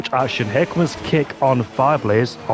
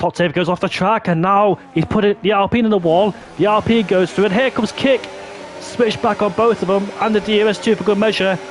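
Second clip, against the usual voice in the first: excited commentary; 7 semitones higher than usual. Real, not acted. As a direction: build the call with excitement. Live call of overtakes.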